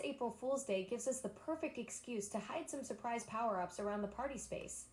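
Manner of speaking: ordinary talk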